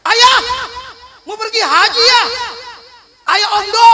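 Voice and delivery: high, loud eager cry; wife thrilled by the hajj offer; mock excited wife. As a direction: shriek with delight.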